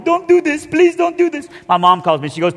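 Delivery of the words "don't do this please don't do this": high, begging mock mother; pleading mom. Mother dragging his leg before baptism, high pleading. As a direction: plead desperately like a clinging mother. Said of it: "dragging my leg going".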